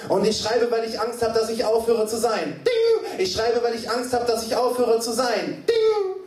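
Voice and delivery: high, driving chant-like refrain; insistent high recitation; urgent slam refrain. Acted. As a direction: punctuate with piercing typewriter dings. Performs poem refrain.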